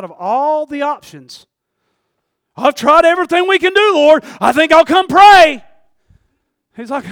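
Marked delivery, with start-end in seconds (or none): 2.6-5.6 high, very loud, hammed-up desperation; exasperated shouted prayer. Churchgoer out of options calls to the Lord, exasperated shouting. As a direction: holler it to heaven, stretching the last words.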